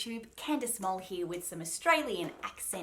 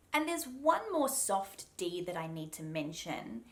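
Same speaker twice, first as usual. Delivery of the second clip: ordinary talk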